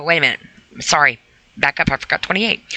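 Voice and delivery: ordinary talk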